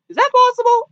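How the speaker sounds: very high, squeaked question; squeaky disbelief. Retold or imitated. Viewer questioning the phone hacking, squeaky mock disbelief. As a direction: jump way up into a mock-incredulous squeak.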